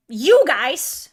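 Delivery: suddenly loud, jabbing at the viewers; loud outburst. Real, not acted. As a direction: burst out at the chat. Ranting at her audience.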